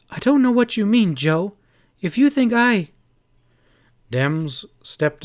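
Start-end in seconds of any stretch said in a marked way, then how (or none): 0.1-2.9 higher, louder flash of anger; indignant. Acted. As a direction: flare up at him indignantly. Kitty flares up at Joe.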